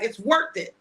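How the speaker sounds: ordinary talk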